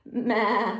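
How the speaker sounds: playful goat sound; goat bleat. Pretend.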